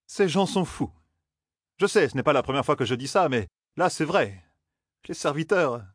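rattled, insisting it's true this time. A narrator's reading. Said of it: "soupira Matt".

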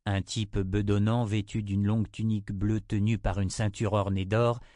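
ordinary delivery

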